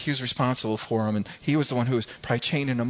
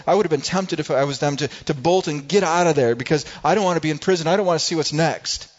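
ordinary talk, nothing marked

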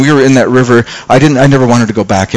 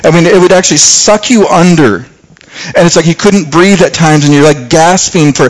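ordinary talk, nothing marked

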